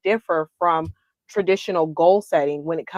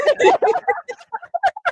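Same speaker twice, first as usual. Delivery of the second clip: high laughter. Real, not acted. Big laugh.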